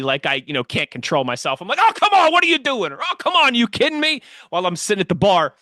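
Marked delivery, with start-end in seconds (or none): 1.8-2.9 blurting at the TV. Retold or imitated. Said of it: "blurt out at the T V". 3.0-4.2 high exasperated outburst; blurting at the TV. Retold or imitated. Fan yelling at the TV over a bad call, exasperated and high. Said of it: "blurt out at the T V".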